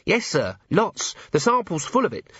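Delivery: high-pitched, eager report to a superior; earnest junior officer. Acted.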